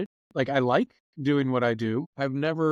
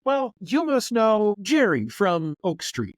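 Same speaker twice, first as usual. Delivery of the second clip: 1 imitating chatty local woman; 8 semitones higher, 3 dB louder, slower than usual. Stranger guesses acquaintances.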